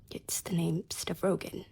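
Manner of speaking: slow broken whisper; a hushed whisper of despair; tearful whisper. Acted. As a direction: breathe it out as if the name hurts. Confessing her ruin, in tears.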